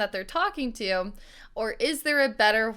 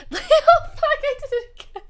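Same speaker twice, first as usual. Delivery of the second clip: very high, collapsing into laughter; laughing too hard; 5 dB louder than usual. Real, not acted. Host botching the line, overcome with laughter. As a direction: squeal it as the giggles take over. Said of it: "I'm laughing so hard".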